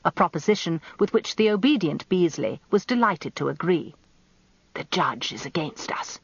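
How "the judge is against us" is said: whispering. A narrator's reading. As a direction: whisper it to the barrister beside you. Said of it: "Danny turned to whisper".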